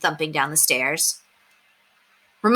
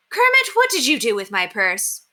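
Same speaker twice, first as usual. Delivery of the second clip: raised voice calling down the stairs; mother calling down from upstairs; 6 semitones higher, 3 dB louder than usual. Acted. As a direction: call up to someone on another floor.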